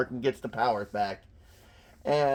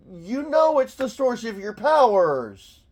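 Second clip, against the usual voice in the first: loud, high-pitched exasperation; raised voice, pitched way up; exasperated; 6 semitones higher, 5 dB louder than usual. Real, not acted. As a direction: berate the hero in disbelief. Exasperated aside at the comic's hero.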